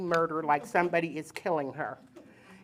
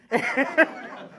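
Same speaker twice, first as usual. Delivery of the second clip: a short high laugh; amused laugh; 6 semitones higher than usual. Real, not acted. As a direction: laugh at the joke. Laugh at doctor's reply.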